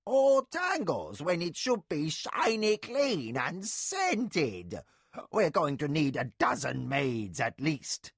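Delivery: high, loud, slow outraged cry; loud high scandalized nurse; ranting. A narrator's reading. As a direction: rant at your mistress's messy hair. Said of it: "Nakoya ranted on".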